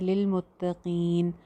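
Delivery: slow Quranic recitation of लिल मुत्तकीन; recitation demo. Shown as a demonstration. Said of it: "एक word आता है".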